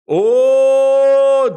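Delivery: long, loud, high holler; excited sustained cry; hyped-up oh. Real, not acted. Hyping the deep dive.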